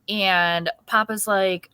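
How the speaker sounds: normal talking voice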